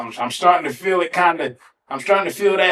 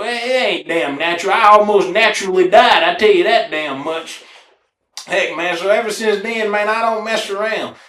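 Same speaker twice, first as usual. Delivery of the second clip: normal talking voice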